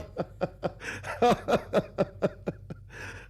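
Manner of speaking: drawn-out staccato cackle; long mocking laugh. Narrator explains man and woman, long laugh. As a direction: laugh on and on, breathily.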